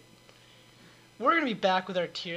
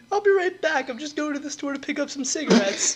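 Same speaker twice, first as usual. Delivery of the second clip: absent dad voice; 8 semitones higher, 5 dB louder than usual. Retold or imitated. Dad leaving for cigarettes.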